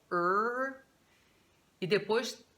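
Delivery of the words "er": "er" held as a single r-coloured vowel, slightly raised in pitch; isolated er sound. Shown as a demonstration. Teacher showing where the tongue is, a held American "er". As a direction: draw out "er" with the tongue pulled into an American r. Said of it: "sentindo onde é que tá a língua".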